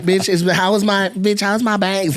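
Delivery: high-pitched imitation of fussing girls; high mock-feminine chatter; primping girlfriends. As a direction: mimic girls urgently checking their look. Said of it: "They're like, you know".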